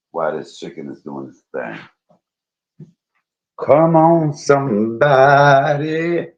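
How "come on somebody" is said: loud, high drawn-out call, over and over. Real, not acted. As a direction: belt it out like summoning a crowd. Calling out loudly, repeated.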